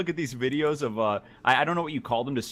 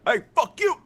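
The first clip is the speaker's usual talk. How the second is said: yelling; 10 semitones higher, 4 dB louder, slower than usual. Retold or imitated. Imitating ref swearing at player.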